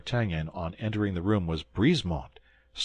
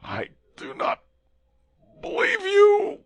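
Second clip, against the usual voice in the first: whispered start breaking into a high loud cry; poisoned soldier's final denial, whisper to cry; dying last cry; 5 dB louder than usual. Acted. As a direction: gasp it out, then cry. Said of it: "cried the soldier".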